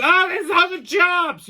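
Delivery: high mock lady voice; shop owner mimic. Retelling the owner's fawning, high mock voice. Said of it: "the woman goes".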